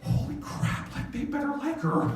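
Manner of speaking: voiceless gasp jumping to high pitch; retold panic, voice leaping way up; freaking out. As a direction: play a parent's anxious freak-out. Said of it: "freaking out".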